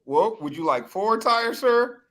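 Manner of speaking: slow, high, overly polite salesman; mock polite salesman. Mocking the tire salesman for the hosts, slow high and syrupy. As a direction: drag it out like a smarmy clerk. Salesman voiced in retold scene.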